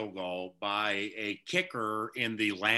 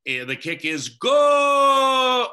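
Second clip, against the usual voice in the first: slow, much higher shouted call; replaying his own radio yell; re-enacted kick call; 14 semitones higher, 10 dB louder, much slower than usual. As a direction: belt it out like the live broadcast. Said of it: "and I said, basically".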